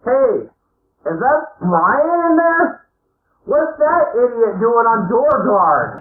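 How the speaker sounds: grumpy guard behind the door; muffled guard voice. Acted. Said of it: "a muffled voice comes through and goes".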